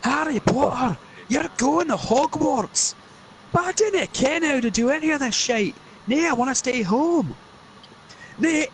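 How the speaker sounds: high Scottish bellowing argument; Scottish Hagrid rant. Acted. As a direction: shout Harry into going. Scottish Harry Potter parody, arguing.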